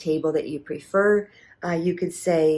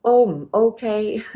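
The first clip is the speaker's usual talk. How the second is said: louder and higher, stretched slowly; slow, drawn out; 5 semitones higher, 5 dB louder, slower than usual. Shown as a demonstration. Teacher models a waiter's reply, slow drawn out. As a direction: draw out "Oh, mm, okay." slowly and loud. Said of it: "high tone".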